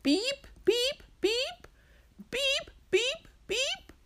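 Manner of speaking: very high, piercing electronic beeps; imitated beeping. Storyteller voicing the noise Marcia hears, high beeping. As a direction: mimic an alarm in groups of three. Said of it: "hearing that noise".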